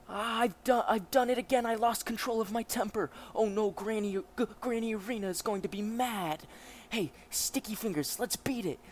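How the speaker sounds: high, alarmed character voice; high-pitched comic dismay; panicking young hero. Acted. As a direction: fret loudly over losing your temper.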